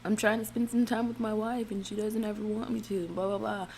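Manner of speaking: put-on protest fading into blah-blahs; mock protesting husband. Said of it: "it was funny how he said it".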